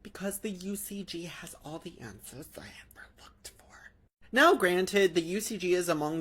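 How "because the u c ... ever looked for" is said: hushed whisper. Real, not acted. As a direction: whisper it like a secret aside. Mocking aside about UCG.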